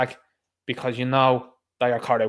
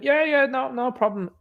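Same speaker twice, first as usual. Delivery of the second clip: much higher, breezy mocking yes-man; mock pushover official; 10 semitones higher than usual. Host mocking officials letting everyone in, pitched much higher. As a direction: squeak out an eager mock yes. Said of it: "they'll roll them in".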